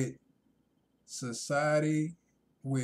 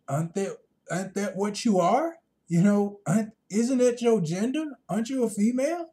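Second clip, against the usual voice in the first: incredulous retort; 7 semitones higher, 6 dB louder than usual. Retold or imitated. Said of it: "So I'm like".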